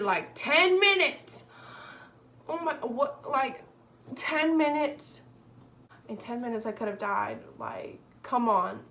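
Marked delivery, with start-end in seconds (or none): 0.3-5.2 higher, slow, loud disbelief; indignant disbelief. Real, not acted. Teen complaining about the ER wait, indignant. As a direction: stretch out the outrage.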